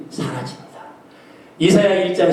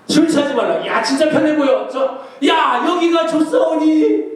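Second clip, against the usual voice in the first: higher, loose, laughing tipsy voice; mock drunk; 11 semitones higher than usual. Pastor mocking a drunk in a picture for the youth, lightly hammed up. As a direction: play the happy drunk for laughs.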